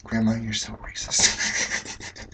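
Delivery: slow, sleepy whisper just after waking; mumbled waking whisper at grandma; groggy whisper. Retold or imitated. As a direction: breathe it out half asleep, slowly. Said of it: "I'm just like".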